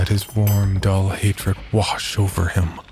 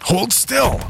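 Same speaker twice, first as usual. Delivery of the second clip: much higher panicked call; frantic command. Acted. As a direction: urge him to stop moving. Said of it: "babbling mindless instructions".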